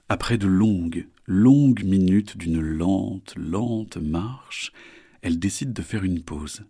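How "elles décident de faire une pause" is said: usual speaking voice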